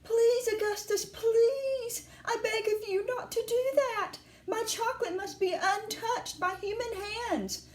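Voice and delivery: high-pitched, imploring Mr Wonka; high anguished plea; distressed pleading cry. A narrator's reading. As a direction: cry out in dismay, begging him to stop. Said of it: "he cried out".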